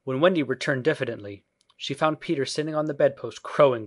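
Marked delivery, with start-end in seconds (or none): none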